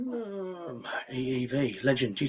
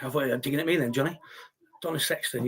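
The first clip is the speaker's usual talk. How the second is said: normal talking voice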